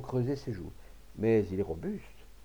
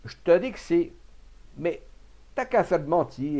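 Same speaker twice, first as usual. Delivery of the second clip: louder, higher, insistent retort; raised voice; 6 semitones higher, 6 dB louder than usual. A narrator's reading. The farmer overruling Rabalan's denial, raised voice. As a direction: raise your voice to overrule him. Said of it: "éleva la voix".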